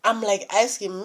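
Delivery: ordinary talk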